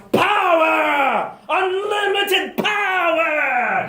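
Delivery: screaming Emperor with the final word dragged out; ranting Emperor. Retold or imitated. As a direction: bellow it like a power-mad tyrant. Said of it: "the Emperor strikes and he says".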